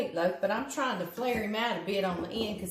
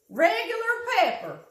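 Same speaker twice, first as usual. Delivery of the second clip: excited; 13 semitones higher, 7 dB louder than usual. Real, not acted. Exclaimed high and loud.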